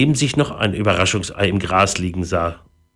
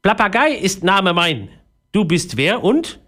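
high, inquisitive parrot; 10 semitones higher than usual. A narrator's reading. Parrot introduces itself to Philipp, high parrot voice. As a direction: introduce yourself in a high bird voice. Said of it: "sah Philipp mit geneigtem Köpfchen an".

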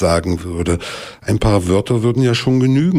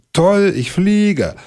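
very high, slow, dreamy delight; thrilled thought; 12 semitones higher, much slower than usual. Acted. Bear falling from the tree thinks he flies, high and slow. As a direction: savour the feeling of flying, stretched out.

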